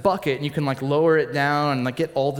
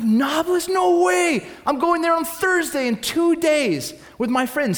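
high, loud burst of amazed excitement; excited disbelief; 12 semitones higher, 4 dB louder than usual. Retold or imitated. Tourist to soldiers from Nablus, excited disbelief. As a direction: blurt it out high, thrilled by the coincidence.

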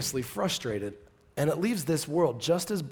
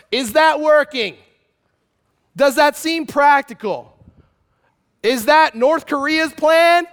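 much higher and louder, driving hard; full-force preaching; 10 semitones higher, 10 dB louder than usual. Acted. Preacher hammering the congregation with rhetorical questions, full force.

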